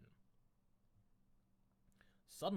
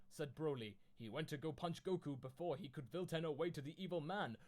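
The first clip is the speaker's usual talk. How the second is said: ordinary delivery, nothing marked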